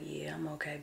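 faint, breathless whisper through tears; teary whisper. Real, not acted. Mom reassures son Cameron while crying, teary whisper. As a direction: hold back the tears, barely voiced.

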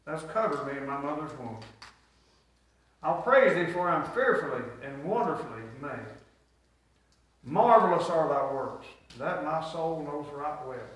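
normal talking voice

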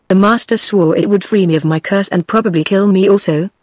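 ordinary delivery

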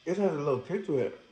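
eating a burger mid-sentence; talking while chewing. Real, not acted. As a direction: mouth partly full of food. Seen 0: Holds bitten burger, jaw working between words; bites again after.

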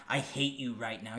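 flat and earnest, no teasing; serious. Shown as a demonstration. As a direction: say it like you really mean it. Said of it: "You're not like".

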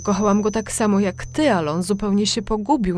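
high, loud pleading explanation; pleading mother. A narrator's reading. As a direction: beg her daughter to understand.

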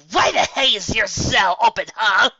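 high, loud angry shout; shouting angrily. A narrator's reading. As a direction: yell at her furiously. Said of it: "she shouted at Scootaloo".

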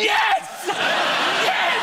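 very high shouted cheer; high squealed yes, laughing; overjoyed kid. Retold or imitated. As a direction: cheer like a delighted little boy. Boy overjoyed at autograph.

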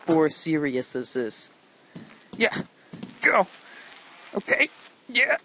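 usual speaking voice

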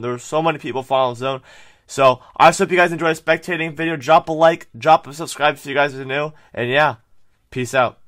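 loud, energetic channel intro; hyped intro. Acted. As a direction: pump up the viewers fast.

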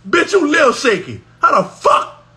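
ordinary talk

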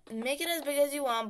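usual speaking voice

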